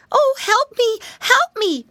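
very high, loud piglet plea; crying for help. A narrator's reading. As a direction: squeal for rescue as a tiny pig. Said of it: "cried Flop".

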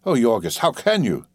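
plain reading voice

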